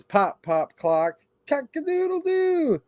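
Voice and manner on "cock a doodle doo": much higher rooster call; rooster crow. Acted. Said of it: "He can sound like a rooster".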